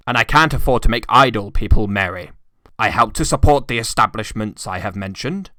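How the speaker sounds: put-on harsh old miser. A narrator's reading. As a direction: refuse the gentlemen flatly. Scrooge refuses charity.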